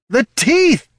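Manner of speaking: high, loud wail of alarm; hammed-up terror; panicked cry. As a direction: cry out as if being bitten. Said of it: "the bard cried".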